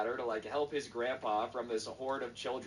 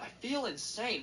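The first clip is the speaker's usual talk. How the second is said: high-pitched exasperated retelling; frazzled, acted-out exasperation; 11 semitones higher than usual. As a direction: act out a frazzled searcher, pitch jumping up. Exasperated cousin quoted searching room.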